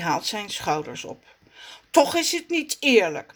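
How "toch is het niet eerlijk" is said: plain reading voice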